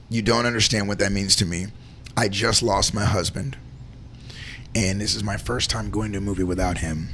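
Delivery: quietly moved. Retold or imitated. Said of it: "she looks at me and says".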